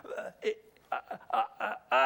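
mime being lost for words; speechless stammering. Acted. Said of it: "you're speechless".

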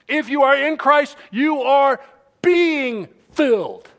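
emphatic preaching. Real, not acted. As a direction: preach it forcefully, hammering each word. Preaching at full force.

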